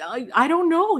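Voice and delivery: imitating your own flustered hesitation; nervous stutter. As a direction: stumble over the first word, nervously. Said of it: "now I'm stuttering and I'm nervous".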